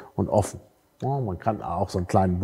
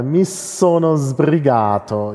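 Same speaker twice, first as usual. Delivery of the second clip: slow, careful Italian read; slow model sentence in Italian; slow Italian demo; 3 semitones higher, much slower than usual. Shown as a demonstration. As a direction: lay out each syllable of „mi sono sbrigato“ slowly. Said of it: "Italian example".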